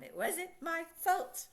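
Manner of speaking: mock kid excuse. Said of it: "I hear that all the time".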